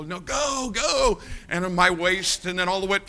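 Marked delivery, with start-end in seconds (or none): 0.0-1.2 high-pitched retold command; mock urging. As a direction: urge him on, voice pitched up. Brother Johnny ordering him into river.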